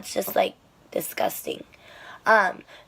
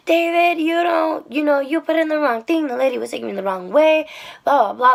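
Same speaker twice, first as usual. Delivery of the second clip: high mock yell, easing into a faster rant; imitated yelling; 5 semitones higher, 3 dB louder than usual. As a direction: mimic an angry phone rant, trailing into blah-blah. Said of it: "like, yelling".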